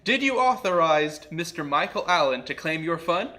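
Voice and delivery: normal talking voice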